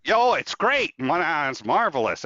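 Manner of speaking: high, loud mock delight; over-bright sarcasm, starting high and easing down; sarcastic enthusiasm. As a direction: gush as if it were all wonderful. Mock answer to whether everything is good.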